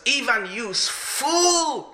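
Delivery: high-pitched, drawn-out scolding; mock fury. Friend berating disguised Napoleon for the spill, mock fury. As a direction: act out outrage at a clumsy servant. Friend acts furious at clumsy Ivan.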